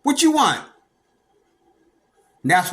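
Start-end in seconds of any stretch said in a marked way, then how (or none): 0.0-0.6 put-on retelling standing up to the boyfriend; confrontational. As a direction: square up and demand it. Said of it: "I said".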